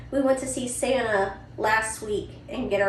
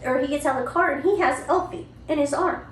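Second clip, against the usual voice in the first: ordinary delivery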